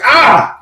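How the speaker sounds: loud, high mock yelp of pain; exaggerated "ah" as if burned; sudden hammed-up cry of pain. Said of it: "Well, he doesn't do that".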